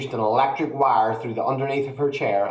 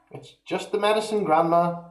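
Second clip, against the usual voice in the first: put-on higher boyish reassurance; calm boy; 7 semitones higher than usual. A narrator's reading. George reassuring grandma, higher. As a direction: brush it off like a cheeky lad.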